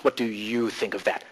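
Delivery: single out "you"; stress on YOU. Shown as a demonstration. Coach repeating the pointed question, stress on "you". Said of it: "Donna's on the spot".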